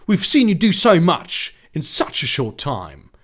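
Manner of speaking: loud, emphatic story dialogue; acted encouragement. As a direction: deliver it as a rousing speech. First take of ally's line.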